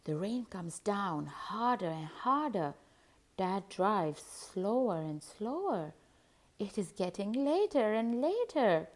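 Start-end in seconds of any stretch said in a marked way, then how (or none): none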